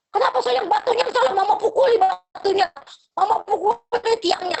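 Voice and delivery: hammed-up mum blaming the stone to soothe her child; coddling mother. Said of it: "namanya bodoh itu jadi orang tua".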